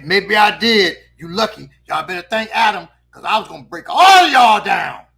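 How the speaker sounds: grudging admission building to a loud yell; hammed-up teacher's warning, loud at the end; threatening teacher. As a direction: back down, then end on a shouted threat. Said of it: "That was her".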